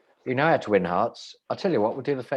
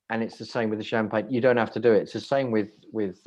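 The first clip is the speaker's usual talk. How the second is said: usual speaking voice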